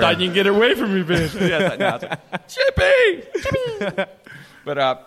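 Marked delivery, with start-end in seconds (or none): 0.0-1.3 fast, mock-tough taunt; taunting dog voice. The dog taunts Jimmy, fast mock-tough voice. 2.5-4.0 high, strained calls of the name; calling out. Retold or imitated. Jimmy cries out to the dog, high panicked call.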